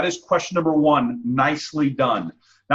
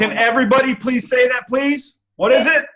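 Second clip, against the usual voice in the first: excited; 9 semitones higher, 5 dB louder than usual. Real, not acted. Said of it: "coaxing the group to repeat".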